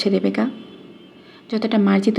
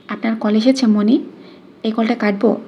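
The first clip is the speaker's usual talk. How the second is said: ordinary delivery, nothing marked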